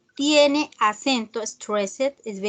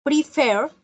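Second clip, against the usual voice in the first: "Prefer" higher, with weight on FER; stress on last syllable; 4 semitones higher, 3 dB louder than usual. Shown as a demonstration. Teacher repeating an example for students, "Prefer" stressed on FER. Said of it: "tiene el acento en la última".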